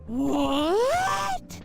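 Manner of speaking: long rising "What?"; mock disbelief. Streamer reacting to friends who can tell, mock surprise.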